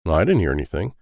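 ordinary delivery, nothing marked

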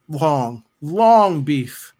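ordinary delivery

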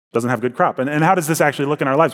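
ordinary talk, nothing marked

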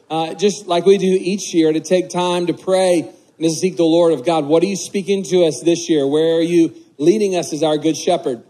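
ordinary talk, nothing marked

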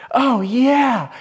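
hammed-up seductive moan; mock romantic moan, high and stretched. Shown as a demonstration. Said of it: "The moan".

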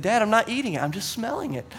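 imitating a toddler's quiet protest; two-year-old son. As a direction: plead innocence like a little kid. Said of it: "and Jack, my two-year-old".

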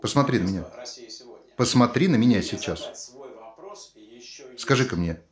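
calm her down gently; soft reassurance. Acted. Husband calming his frightened wife, low and gentle.

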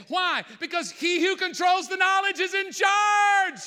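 shouted high and loud; preaching shout. Real, not acted. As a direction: thunder it from the pulpit. Preaching climax.

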